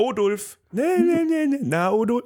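put-on high, singsong baby talk; parent calling baby. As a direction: coo at a tiny baby.

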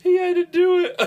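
very high, loud squeal through laughter; helpless laughter. Real, not acted. Friend retelling the gross copycat dare, helplessly laughing. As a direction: crack up so hard the words come out squealing.

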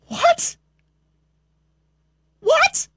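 high rising disbelief; incredulous. Real, not acted. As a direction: squeak it out in disbelief. Incredulous at quote.

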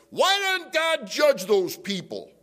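loud, high, drawn-out mock outrage; fussing and fuming. Believer fuming about politicians, indignant yell. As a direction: cry out in indignant protest. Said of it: "we can fuss and we can fume".

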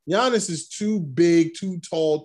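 normal talking voice

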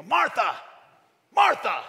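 high, loud scolding call; stern call. Retold or imitated. Jesus scolding Martha, loud sharp call. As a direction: call her name sharply. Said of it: "I tried to imagine different ways".

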